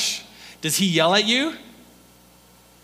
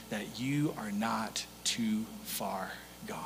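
gentle whisper; 8 semitones lower, 14 dB quieter, slower than usual. Acted. God's tender message to Peter.

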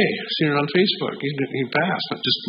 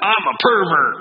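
raised, higher-pitched quoted announcement; mock public confession, pitched up; retold blurted confession; 6 semitones higher, 3 dB louder, slower than usual. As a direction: blurt it out to a packed room as the visiting speaker. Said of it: "looks down, and he says".

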